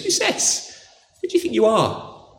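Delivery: high, breathy incredulous scoff; scoffing challenge. Retold or imitated. Elders to Jesus, high incredulous scoff. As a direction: sneer it like an offended elder.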